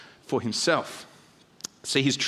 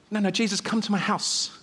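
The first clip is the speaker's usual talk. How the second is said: higher-pitched, lightly acted protest; pleading insistence; 6 semitones higher than usual. Father begging Jesus to come home, pleading.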